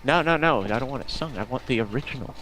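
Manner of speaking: normal talking voice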